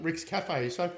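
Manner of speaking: ordinary talk, nothing marked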